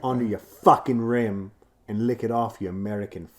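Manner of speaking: put-on British accent with punched swear words. Imagining an actress talking dirty to him, British accent. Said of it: "that British accent".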